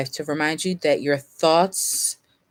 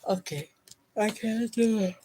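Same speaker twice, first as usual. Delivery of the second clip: high, strained through a yawn; fighting back a yawn; 6 semitones higher, 3 dB quieter than usual. Real, not acted. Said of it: "why I'm yawning".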